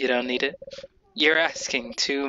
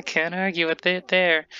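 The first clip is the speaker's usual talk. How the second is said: laughing character; 6 semitones higher than usual. Acted. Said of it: "I apologize for my terrible voice acting".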